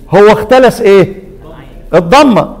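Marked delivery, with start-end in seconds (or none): none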